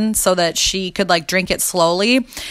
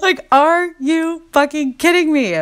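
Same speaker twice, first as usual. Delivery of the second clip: high, slow, half-laughing half-crying; losing it, words bending with laughter; real laughter cracking the voice; 8 semitones higher than usual. Real, not acted. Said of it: "I couldn't keep it together".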